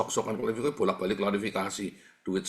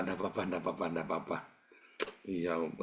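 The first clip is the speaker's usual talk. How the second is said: softer, lower, gently forgiving; soft reassuring quote; 5 semitones lower, 6 dB quieter, faster than usual. Retold or imitated. Owner forgiving the preacher, softly reassuring. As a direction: murmur it like a kind uncle brushing it off. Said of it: "dia cuman ketawa".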